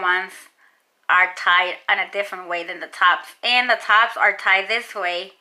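speaking with lip held down; finger pulling lip down. Real, not acted. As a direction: finger hooked in mouth. Seen 0: Finger pulls lower lip down to show braces, 0.8–6.3 s.

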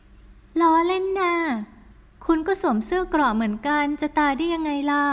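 teasing retort. Acted. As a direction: tease him back. Heroine retorts.

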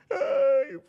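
high, loud put-on crying wail; hammed-up sobbing cry; mock bawl. Crying friend looking for the tissue box, mock bawl.

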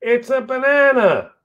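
high, loud, mocking pigeon voice; taunting pigeon. Pigeon taunts Lulu during the chase, high mocking voice.

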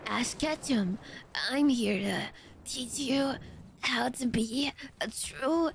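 lightly voiced Ash Ketchum; shonen voice. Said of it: "Shonen voice is kinda close to grandma".

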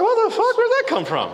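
shocked. Retold or imitated. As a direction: caught off guard. Shocked at rose demand.

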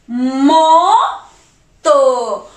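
"Moto" broken into Mo, pause, to; syllable by syllable. Shown as a demonstration. Teacher sounding out the word for children, syllable by syllable. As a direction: sound out "Moto" in two separate, stretched beats. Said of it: "Esta de acá dice".

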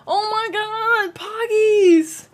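loud high thrilled cheer; excited shout. Real, not acted. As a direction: yell it out with joy. Thanking gifted subs excitedly.

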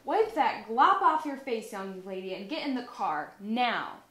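a mother ordering her daughter, no nonsense; stern mother. Acted. Mother telling Olivia to wipe off the makeup, loud and stern.

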